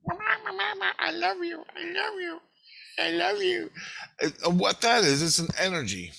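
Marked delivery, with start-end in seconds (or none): 0.0-3.7 very high-pitched, sing-song pleading voice; imitated priestess. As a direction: chant it high like a nagging spirit. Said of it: "the priestess".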